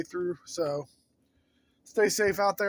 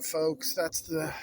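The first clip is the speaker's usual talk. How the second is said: ordinary talk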